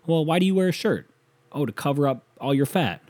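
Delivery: hammed-up snide clapback; mocking comeback. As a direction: sneer it back like a schoolyard bully. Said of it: "I'd be like".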